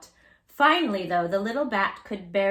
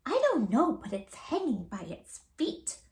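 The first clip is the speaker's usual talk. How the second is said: high chirpy little bird voice, fading; chirped; 3 semitones higher than usual. Acted. Baby bird Flitter puzzling over the bat, chirpy. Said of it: "chirped Flitter".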